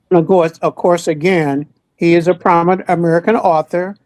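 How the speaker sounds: usual speaking voice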